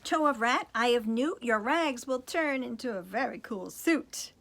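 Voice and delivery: loud, high sing-song spell chant; fairy casting a spell. Acted. Fairy chants a spell over Prince Cinders, loud and high.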